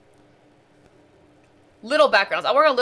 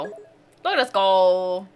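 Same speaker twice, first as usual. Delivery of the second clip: stretched slow, sing-song look at us go; slow drawn out; 4 semitones lower, much slower than usual. Real, not acted. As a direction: draw it out proudly.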